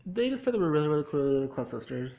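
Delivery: normal talking voice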